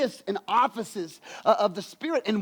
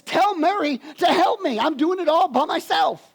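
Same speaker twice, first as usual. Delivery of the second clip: louder and higher, fed-up complaint; complaining Martha; 7 semitones higher, 4 dB louder than usual. Acted. Martha to Jesus, fed-up higher complaint. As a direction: whine it like the overworked sister. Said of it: "and she says".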